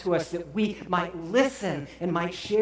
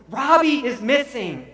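wife's distraught, high-pitched shout; panicked shout; 9 semitones higher, 9 dB louder than usual. Retold or imitated. Sobbing wife tells her husband their baby is missing, shouting. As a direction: cry out loud and much higher. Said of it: "she shouted".

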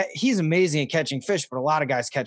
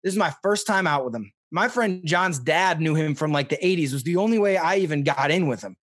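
normal talking voice

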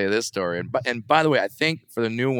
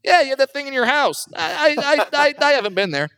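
1 mock woman's voice. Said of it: "she's like".

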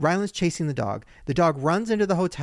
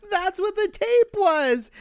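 high, slow dawning realization; sudden realization; slower than usual. Retold or imitated. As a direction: let it dawn on you slowly, pitched up. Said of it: "And I'm like".

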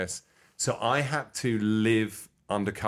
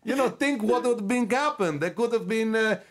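gushing foreign interviewer; 14 semitones higher than usual. Retold or imitated. Praising the guest as a hero, high excited put-on accent. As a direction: gush with wide-eyed praise in a foreign voice. Character voice continues.

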